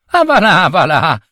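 put-on high, dismissive little boy; acted incredulous jeer; scoffing. A narrator's reading. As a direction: brush it off in a child's high voice. Boy scoffs at the servant's pretence.

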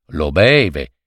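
ordinary delivery

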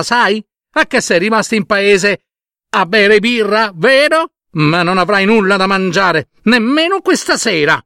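high-pitched angry wife; scolding wife. Acted. Wife berates her late-returning husband, high scolding voice. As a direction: scold him high and indignant.

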